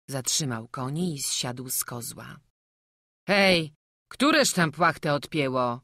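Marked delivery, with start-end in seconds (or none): 3.3-5.8 loud, annoyed driver's call; raised voice demanding who did it. Acted. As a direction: shout back into the wagon. Driver calls into the wagon.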